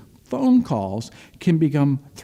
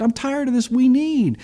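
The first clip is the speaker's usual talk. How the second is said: higher-pitched, fed-up complaint; retold frustration; 8 semitones higher than usual. Friend complaining about his demanding dealer, acted exasperation. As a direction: voice a friend sick of being badgered. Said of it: "I'm just frustrated".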